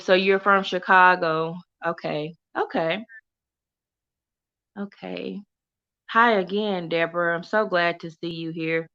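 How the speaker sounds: normal talking voice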